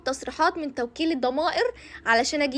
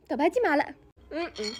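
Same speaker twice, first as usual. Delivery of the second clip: ordinary delivery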